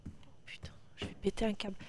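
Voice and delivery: whispered, almost silent; a real breathy whisper of exasperation; under-breath whisper. Real, not acted. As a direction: mutter it to yourself, near breaking point. Losing patience, muttering under breath.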